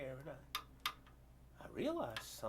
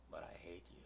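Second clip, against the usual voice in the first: hateful whisper; 9 semitones lower, 12 dB quieter than usual. Retold or imitated. Voicing the man's hatred.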